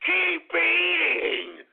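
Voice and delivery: high, drawn-out jeering taunt from an online troll; mocking troll taunt. Host mimicking Twitter trolls who mock him, jeering. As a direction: sneer it like a schoolyard bully. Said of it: "that's what they're saying to me on Twitter".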